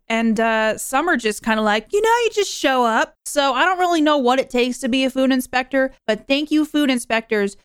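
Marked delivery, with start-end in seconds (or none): 1.9-3.1 high, offhand imitation; mock-casual quote. Podcaster imitating loose job advice, high and casual. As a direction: toss it off in a high voice. Voicing casual online claim about job requirements.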